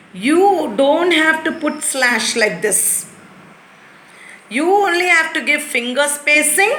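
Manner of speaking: usual speaking voice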